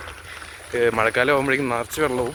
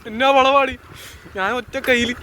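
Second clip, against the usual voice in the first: alarmed shout; 10 semitones higher than usual. Real, not acted. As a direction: cry out on a tight turn. Sharp bend, one hand.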